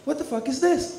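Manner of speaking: high-pitched disbelief; incredulous scolding. Acted. Father confronting daughter over vulgar talk, high incredulous scolding.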